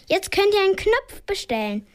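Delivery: mild mock shout. A narrator's reading. Little sister Flo calls to the lift passengers, high and eager. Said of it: "ruft Flo ganz laut".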